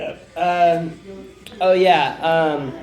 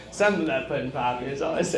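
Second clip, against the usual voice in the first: ordinary talk, nothing marked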